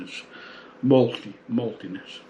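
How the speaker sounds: ordinary talk, nothing marked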